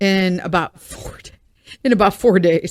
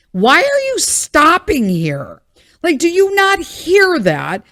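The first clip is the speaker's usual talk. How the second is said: higher, louder and slow, incredulous; exasperated rant; 8 semitones higher, 5 dB louder, slower than usual. Retold or imitated. Scolding walkers who stop outside the house, higher and louder in disbelief. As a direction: demand an answer in disbelief.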